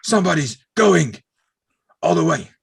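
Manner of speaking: loud, high mock wrestler catchphrase, chopped in two; halting imitated promo shout; Ahmed Johnson promo. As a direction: holler it in separate bursts, imitating a promo. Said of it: "But that's the way he said it".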